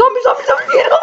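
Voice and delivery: high, loud, laughing scream; real squealing game panic; panicked shout. Real, not acted. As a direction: yell rapidly through giggles. Zombie attack in game.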